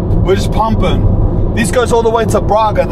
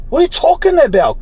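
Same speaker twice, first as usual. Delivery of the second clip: incredulous; 9 semitones higher, faster than usual. Real, not acted. Reacting to the fire warning.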